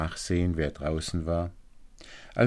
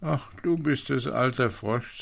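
higher princess voice; princess in distress; 6 semitones higher than usual. A narrator's reading. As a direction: answer as the upset princess, pitched up. Weeping princess answers the frog. (Prinzessin in Not, mit hoher Stimme)

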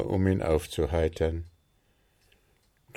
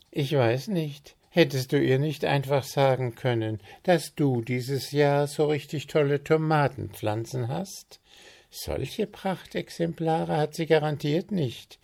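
higher, lightly voiced helpful friend; offering an idea eagerly, a touch higher; Willi chiming in; 8 semitones higher than usual. Friend offers a cake name, lightly voiced higher.

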